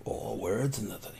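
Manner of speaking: hushed, barely voiced grumble; whispered aside. Tuck answering a threat of words later, whispered. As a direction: whisper it under your breath.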